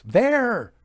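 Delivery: sharp, high, loud cry of There!; exclamation. A narrator's reading. Clairvoyant in the dark crying out to the sitters: high, loud exclamation. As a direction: fling it out as she sees something. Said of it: "These exclamations".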